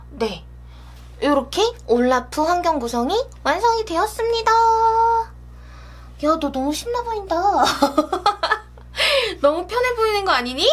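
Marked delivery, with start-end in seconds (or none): 4.5-10.7 playful teasing. Acted. Talking playfully to Olaf figure.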